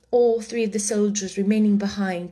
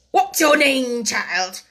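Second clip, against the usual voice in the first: loud, raised-pitch demand; imperious Queen; 3 semitones higher, 5 dB louder, slower than usual. A narrator's reading. The Queen turns to Alice, loud and imperious. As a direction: question like a commanding queen.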